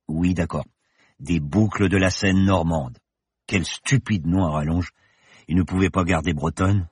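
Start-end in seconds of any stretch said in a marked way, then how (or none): none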